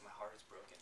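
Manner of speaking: quiet, near-tearful lament; a hint of tears in a soft voice; crying tone. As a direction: let the heart break on the words. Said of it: "a crying tone".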